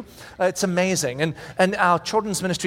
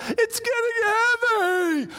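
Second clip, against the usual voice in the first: straining cry; much slower than usual. Retold or imitated. Eleven-year-old straining under the weight.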